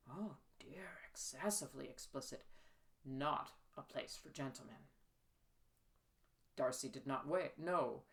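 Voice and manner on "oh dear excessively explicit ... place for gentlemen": breathless shocked whisper; a prim gentleman whispering his shock; scandalized whisper. Acted. Shocked aside over explicit book.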